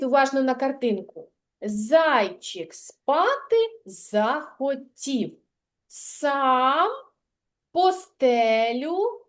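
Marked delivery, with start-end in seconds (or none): 1.6-9.2 slow, stretched words, left open for completion; slow verse prompt. Shown as a demonstration. Teacher prompts child for the last words of lines, slow and expectant. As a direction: recite slowly, pausing for the child to finish. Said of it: "підкажи останні слова рядочки".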